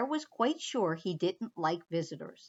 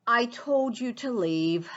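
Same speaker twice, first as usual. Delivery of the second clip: bear crying out. Acted. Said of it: "cried the bear".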